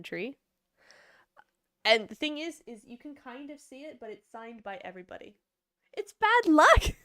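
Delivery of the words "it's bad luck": high, loud excited yelp; playful high-pitched outcry; bad luck protest. Real, not acted. Reacting to chat about jersey.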